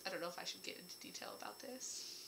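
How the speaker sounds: whispered aside to herself. Real, not acted. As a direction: drop to a hushed whisper, unsure. Hesitating over story spoilers.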